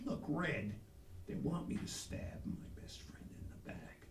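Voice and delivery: low, hushed admission; secretive, dropped-voice aside; reluctant confiding. Acted. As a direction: confess it almost in a whisper. Reluctant confession to father.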